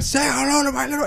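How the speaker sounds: high-pitched movie gangster impression; Tony Montana. Gangster pulling out his guns, hammed-up impression. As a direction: put on a film gangster's voice, high. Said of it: "like Tony Montana".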